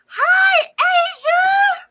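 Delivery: high excited shout of a name. Real, not acted. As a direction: call the name out loud and high. Greeting a chat viewer.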